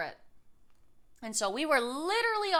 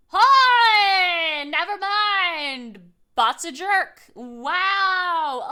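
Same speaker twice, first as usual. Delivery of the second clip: loud, much louder mock outrage at the bot; outraged outburst; 5 semitones higher, 14 dB louder, much slower than usual. Real, not acted. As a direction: burst out, then drag "Never mind" out slowly. Reacting to rude chat bot.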